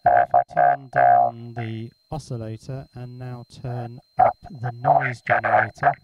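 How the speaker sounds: speech vocoded through digital noise; robotic vocoder voice made out of noise. Shown as a demonstration. Maker demonstrating the noise generator, noise-vocoded voice. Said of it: "now my voice is modulating the noise".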